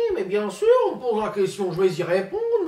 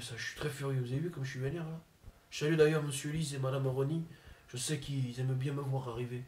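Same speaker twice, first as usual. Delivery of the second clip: Zinedine Zidane impression; 2 semitones lower, 7 dB quieter than usual. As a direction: mumble like a shy, sulky footballer. Comedian impersonates Zinedine Zidane.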